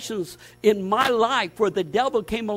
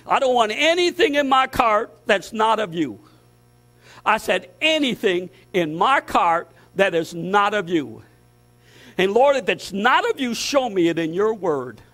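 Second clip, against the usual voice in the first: rising, louder sermon list hammered out; fiery preaching; 4 semitones higher, 5 dB louder than usual. Acted. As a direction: preach it hard, build the list. Preaching builds again.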